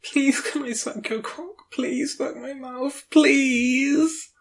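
high, frantic begging voice; desperate. Acted. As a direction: plead over and over, beyond caring. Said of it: "I know I sound desperate, nonsensical".

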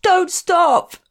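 high, loud, desperate shriek; anguished shriek. Acted. Man answering the narrator's question, anguished shriek. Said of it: "shrieked in a voice that resonated with anger".

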